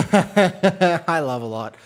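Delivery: laughing out the name. Real, not acted. Streamer reads viewer name Love a Lot, laughing. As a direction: crack up at the funny name.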